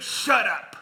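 sharp contemptuous shut up; curt dismissal. Shown as a demonstration. Preacher voicing Micah, cutting the audience off with scorn. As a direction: cut them off with disgust. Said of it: "That's the tone".

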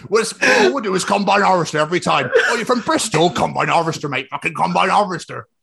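Bristol yokel with laughter in it; mock Bristol accent. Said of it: "when they wanna do a Bristol or Southern accent".

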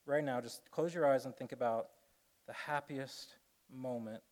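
slowing, fading meditation voice; hushed guided meditation trailing off; guided reflection. Acted.

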